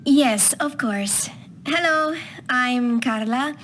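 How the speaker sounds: usual speaking voice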